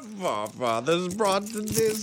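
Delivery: put-on Borat accent. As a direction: drift into a Borat impression. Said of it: "you turned into Borat by the end".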